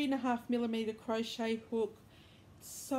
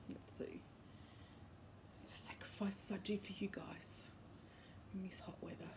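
whispering; 2 semitones lower, 13 dB quieter than usual. Real, not acted. Whispered aside while filming.

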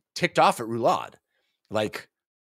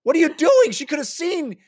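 higher, louder mock scolding; mock furious shout; 3 dB louder, faster than usual. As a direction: yell at the fool, hammed-up. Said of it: "Featherwitch is like".

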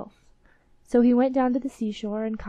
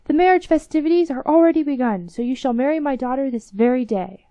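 higher, louder, delighted announcement; excited king; 5 semitones higher, 8 dB louder than usual. Acted. King orders the wedding for today, excited announcement. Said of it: "cried the king".

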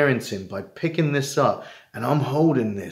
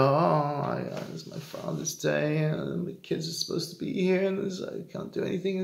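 lightly mock the moaning self; mocking self-pity; 6 semitones higher than usual. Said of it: "I'm making fun of me".

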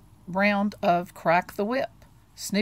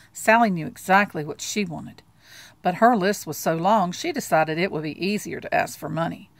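ordinary delivery

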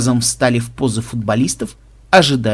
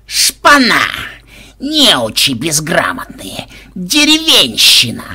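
enraged cursing; 3 semitones higher, 3 dB louder, slower than usual. A narrator's reading. Enraged Koschei cursing the fleeing players, in furious bursts. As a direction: hurl each insult, dragging it out in fury. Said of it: "ругань рассвирепевшего Кощея".

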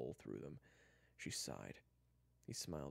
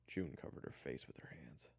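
ordinary delivery

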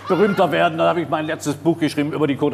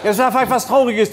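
loud, higher-pitched exasperated outburst; exasperated insistence; 6 semitones higher, 3 dB louder than usual. Real, not acted. Guest fighting to tell the sad part, exasperated. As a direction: push through the interruption, voice raised. Guest shouts over host's interruption.

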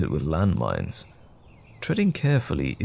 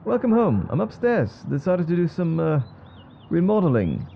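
calling upstairs; 8 semitones higher than usual. Acted. Calling to partner arriving home.

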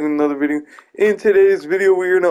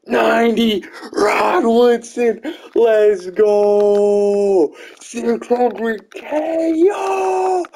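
rising excited shouts, high pitched; excited hype; 6 semitones higher, much slower than usual. Real, not acted. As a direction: yell with joy at a big pull. Rare card pulled in pack.

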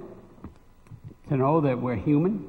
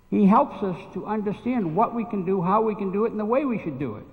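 preaching; 5 semitones higher than usual. Acted. Preaching builds in pitch.

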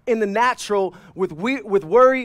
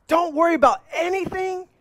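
mock incredulous; 10 semitones higher than usual. Repeats verse in the same high voice.

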